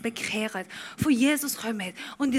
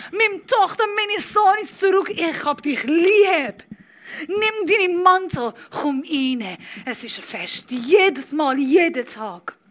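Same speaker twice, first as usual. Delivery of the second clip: louder, rising preacher's build, punching each line; full-force preaching; 8 semitones higher, 8 dB louder than usual. Acted. Preacher telling the prodigal son story to the church, full-force preaching. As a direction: preach it hard to the congregation.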